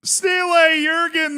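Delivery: high, slow announcer shout; hype shout. Real, not acted. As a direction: call the name like an arena host. Shouting out a subscriber name.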